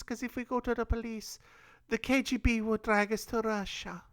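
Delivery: put-on high, soft old-lady voice; grandmother voice. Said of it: "you sounded like your grandmother".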